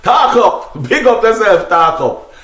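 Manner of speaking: ordinary talk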